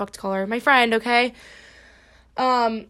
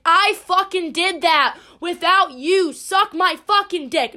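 defiant boast; 5 semitones higher, slower than usual. Retold or imitated. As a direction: rub it in their face. Imagined triumphant boast to ex.

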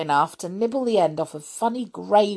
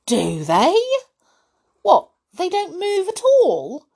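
high, loud amazed question; boy amazed photos don't move; 14 semitones higher, 6 dB louder than usual. A narrator's reading. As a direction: Ron can't believe photos stay still. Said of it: "Ron sounded amazed".

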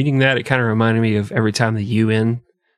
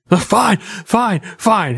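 mock giving in; 8 semitones higher, much slower than usual. Tortured Templar gives in, acted.

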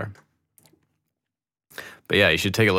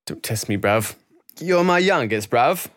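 imitating British accent; 4 semitones higher than usual. Quoting [name] to viewers, a hammed-up London accent. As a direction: hit "bruv" like a London grime MC. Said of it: "the British accent".

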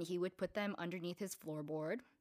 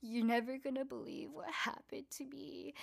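higher, wispy mock-sad voice; imitated sadness; 6 semitones higher than usual. Girl with bandaged cheek confiding in her friend, mock sad. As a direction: act out a friend's sorrow, pitched up. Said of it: "like, she's so sad".

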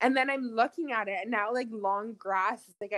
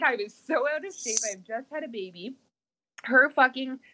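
ordinary delivery

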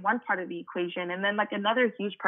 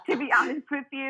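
laughing; 8 semitones higher than usual. Real, not acted. Laughing at the question.

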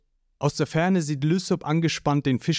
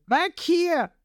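fearful order. A narrator's reading. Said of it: "vor Angst gibt Lysop den Befehl".

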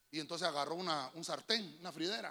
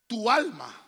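shouted, slow, building sermon climax; preaching at full force; 20 dB louder, slower than usual. Acted. Pastor rousing congregation to clap for the King, shouting. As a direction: preach it loud to the rafters.

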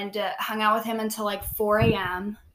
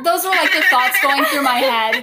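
louder, higher, laughing through the words; 6 semitones higher, 9 dB louder than usual. Real, not acted. Amused by her own thoughts, telling her friends: laughing through it. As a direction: let the laugh break through as you admit it.

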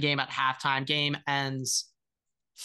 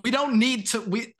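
high-pitched, urgent reassurance; insistent protest; 8 semitones higher than usual. Real, not acted. Co-host talking a scared fan down, insistent protest. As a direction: jump up high to cut the worry off. Insisting friend has no worries.

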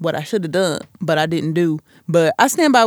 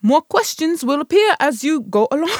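higher-pitched, amateur attempt at an English accent; mock English accent; 6 semitones higher than usual. Podcaster rereading the quiz intro for fun, mock English accent. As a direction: put on a hammed-up English accent. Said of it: "read that in an English accent".

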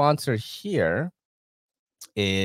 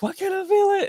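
confused high squeak; 3 dB louder than usual. Retold or imitated. Retelling confusion scratching back.

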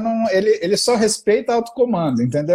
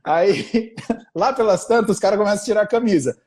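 normal talking voice

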